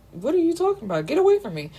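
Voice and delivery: high, indignant get away from me; defiant heroine. Retold or imitated. Heroine pushes Mayhem away.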